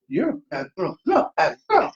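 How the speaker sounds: mock-stern scolding of a pet, rising sharply on the second; sudden high squeaky pet scolding; scolding the dog. Real, not acted. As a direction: tell the dog off, half playful. Scolding his dog while live.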